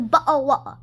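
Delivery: high mock British "Butter water". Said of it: "and he says".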